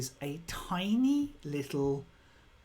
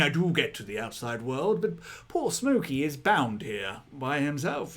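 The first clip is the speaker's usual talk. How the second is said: usual speaking voice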